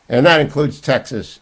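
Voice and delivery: ordinary delivery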